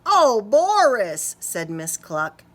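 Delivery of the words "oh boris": louder, drawn-out sigh of Boris; gentle scolding; exasperated teacher. Acted.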